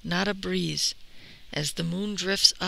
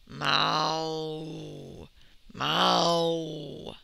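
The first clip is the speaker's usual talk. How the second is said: long, low moo; low like an old buffalo; drawn-out deep lowing; water buffalo bellow; 4 semitones lower than usual. A narrator's reading. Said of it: "An old water buffalo".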